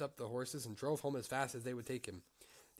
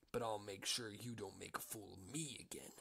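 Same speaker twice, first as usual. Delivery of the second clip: menacing whisper; 3 semitones lower, 5 dB quieter than usual. Acted. Big Claus threatening Little Claus.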